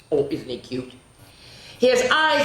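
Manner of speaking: usual speaking voice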